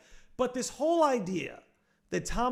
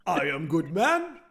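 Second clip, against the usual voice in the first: put-on low, slow Eastern European accent; deep and slow, heavy accent; 5 semitones lower, slower than usual.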